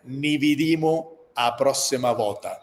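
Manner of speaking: slow farewell in Calabrian dialect. Shown as a demonstration. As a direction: sign off in Calabrian, drawn out. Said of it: "En mi dialecto sería". (despedida en dialecto calabrés)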